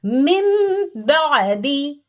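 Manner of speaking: मिन said as मिम, nasal hum held long before बादी; iqlab with ghunna. Shown as a demonstration. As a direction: stretch मिन into a humming मिम for two seconds. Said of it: "हम क्या कहेंगे?". (मिन को मिम बनाकर गुन्ना से खींचना)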